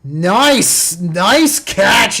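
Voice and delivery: loud, pleased shout of praise; real fan excitement; excited cheer. Real, not acted. Fan reacting to a big catch.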